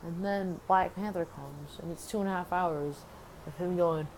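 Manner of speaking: ordinary talk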